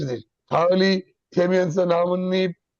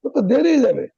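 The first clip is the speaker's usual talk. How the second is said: friend on the phone; 6 semitones higher than usual. Retold or imitated. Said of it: "তুই বলতেছিস".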